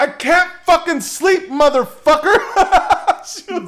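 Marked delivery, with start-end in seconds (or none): 0.0-2.4 high, loud, shrieked insult; furious yell. Retold or imitated. Wife screaming down at the neighbor who keeps her awake, high furious yell. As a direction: scream it down at him.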